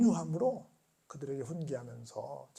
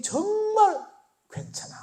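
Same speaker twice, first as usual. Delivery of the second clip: tender comfort; slower than usual. Retold or imitated. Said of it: "하는 눈빛이었다는 거라".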